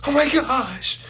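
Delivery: mock panic. People following society fretting over bank money, lightly mocking. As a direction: whine like you just lost everything, half laughing. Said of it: "you gonna be".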